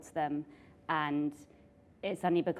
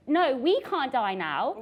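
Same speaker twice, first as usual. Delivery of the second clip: retold livid protest; 6 semitones higher, 7 dB louder, slower than usual. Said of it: "And I was livid".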